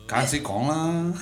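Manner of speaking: slow Cantonese-flavoured Mandarin; Cantonese accent. Retold or imitated. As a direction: drawl it Cantonese style. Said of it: "你不是还会那个粤语吗".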